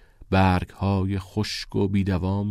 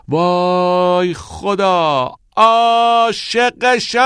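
slow, much higher, loud cry; shout over the wind; 10 semitones higher, 8 dB louder, much slower than usual. A narrator's reading. Neil shouting joyfully over the wind to Todd. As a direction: yell it out over the howling wind. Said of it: "بر فراز باد فریاد زد".

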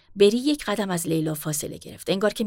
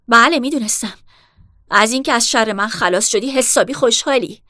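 much louder, bitter sarcastic accusation; bitter outburst; 4 semitones higher, 9 dB louder than usual. Acted. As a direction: throw it at her in resentment. Bitter quarrel with her sister.

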